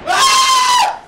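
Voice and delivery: very high, loud shriek; piercing scream of fright. Real, not acted. Player hit by a jump scare, shrieking. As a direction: shriek at the top of your range.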